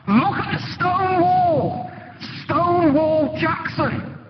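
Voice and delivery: high, slow, drawn-out rallying call; retold battlefield yell. Soldier shouts to the wavering men, high, drawn-out yell. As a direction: stretch it out like a soldier calling across a battlefield. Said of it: "one of the men yells out".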